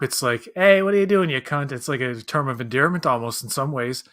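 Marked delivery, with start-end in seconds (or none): 0.6-1.7 cheery, louder pub greeting; friendly greeting. Retold or imitated. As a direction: call it out like greeting a mate. Acted friendly European greeting.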